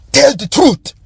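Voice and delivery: high-pitched mock African accent; Will Smith's African accent. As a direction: parody a film's put-on African accent. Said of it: "dark-skinned African doctor".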